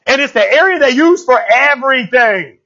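ordinary delivery

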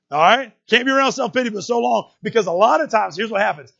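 loud, high, driving preacher's voice; fiery preaching. Acted. Preacher pressing the congregation on self-pity, loud and driving. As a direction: preach it hard and straight to them.

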